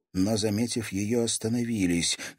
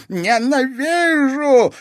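high, loud, drawn-out fury; furious shout, stretched long; hateful shout; 10 dB louder, much slower than usual. A narrator's reading. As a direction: scream it out with hatred. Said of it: "злобно выкрикнул барон".

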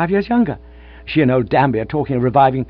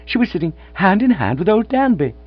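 elated rush; 7 semitones higher, 6 dB louder than usual. Acted. Gussie bursting with happy news.

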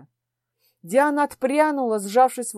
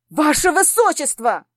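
a higher, louder furious call after the prince; ringing with fury; 5 semitones higher, 5 dB louder than usual. Acted. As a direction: stop him with rage in the voice. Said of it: "Мой голос зазвенел от ярости".

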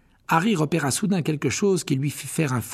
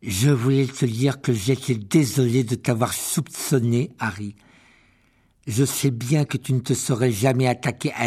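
solemn; 4 dB louder than usual. A narrator's reading. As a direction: make a formal apology. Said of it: "d'un ton solennel".

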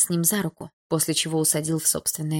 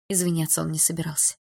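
plain reading voice

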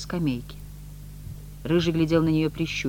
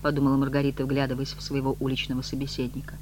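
plain reading voice

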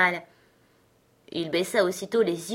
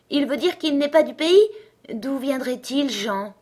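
put-on higher, eager little girl's voice; delighted questioning, lifting high; cheerful exclamation; 6 semitones higher, 4 dB louder than usual. A narrator's reading. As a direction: cry out gaily, pitched up. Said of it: "s'écria tout à coup Lenji gaiement".

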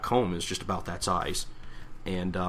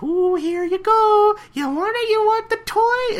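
very high, drawn-out cooing; teasing pet-talk; 7 dB louder, slower than usual. Acted. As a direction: tempt a pet with a toy. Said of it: "waving him around like a toy".

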